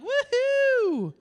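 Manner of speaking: high falsetto whoop; mock cheer. Staff cheering the first push, high falsetto whoop. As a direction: whoop like a cheering delivery room. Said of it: "they were like".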